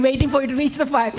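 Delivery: ordinary delivery, nothing marked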